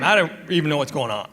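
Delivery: normal talking voice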